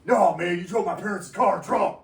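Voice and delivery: громкий возмущённый крик, голос вверх; drunk-driving yell. Retold or imitated. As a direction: yell it at the drunk driver from the back seat. Friend yelling in the car.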